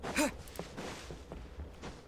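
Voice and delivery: sharp unvoiced intake of breath; surprised gasp. Real, not acted. Streamer gasping at a path that keeps going.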